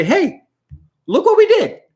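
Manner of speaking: high, excited cheer. Retold or imitated. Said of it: "be able to say".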